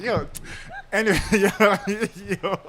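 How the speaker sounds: laughing through the words; cracking up mid-story. Real, not acted. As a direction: caught laughing at his own story.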